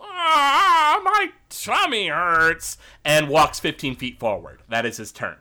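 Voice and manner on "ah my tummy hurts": high drawn-out moan, slowing; bellyaching. Acted. Game master voices Gorbo Borgo standing up, bellyaching wail. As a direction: whine like someone with a sore tummy. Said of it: "bellyache a bit and go".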